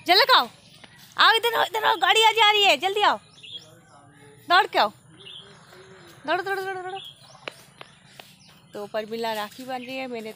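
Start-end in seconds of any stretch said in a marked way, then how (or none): 0.1-4.9 high, loud, urging call; raised voice, insistent and high. Real, not acted. Calling child to hurry over. 6.3-7.0 high, rapid repeated urging; quick high-pitched cheering-on. Real, not acted. Urging child to run.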